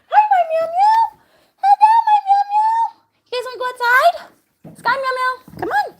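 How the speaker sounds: sky-high, loud doting call; excited pet greeting. Real, not acted. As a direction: squeal a delighted hello to your cats. Calling and greeting cats.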